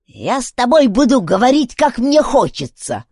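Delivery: parry it in a foul temper; snappish retort. A narrator's reading. Old woman retorts to the girl, bad-tempered. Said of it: "отпарировала миссис Фанджо".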